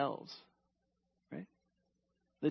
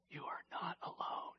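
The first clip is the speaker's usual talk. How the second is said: voiceless whisper, much softer; God's whisper; 10 dB quieter than usual. Acted. Preacher voicing God to the anxious, whispered. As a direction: whisper it close like God near you. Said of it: "he says this".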